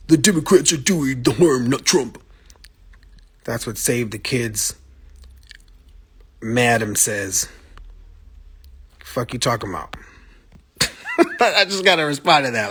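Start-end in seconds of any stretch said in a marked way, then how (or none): 0.1-2.2 slightly raised, mocking read of a viewer's comment; mocking a commenter. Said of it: "repeat what he says". 10.8-11.3 a short laugh of disbelief; sharp laugh. Real, not acted. Laughs at viewer comment. 11.4-12.7 talking through a laugh. Real, not acted. Laughing at viewer comment.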